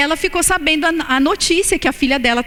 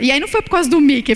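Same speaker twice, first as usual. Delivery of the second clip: normal talking voice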